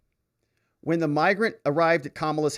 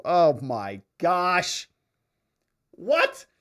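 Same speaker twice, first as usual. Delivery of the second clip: slow "Oh, my gosh" then a much higher "What?"; shocked disbelief; 4 semitones higher, much slower than usual. Real, not acted. Host reacting to a viewer's pineapple pizza comment, in shocked disbelief. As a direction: recoil at the idea, then squeak the question.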